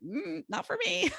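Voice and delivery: high playful mm, not for me; wry refusal. Retold or imitated. Watching friends skinny dip, a playful high refusal. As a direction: decline it with a light amused lilt. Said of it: "And I'm like".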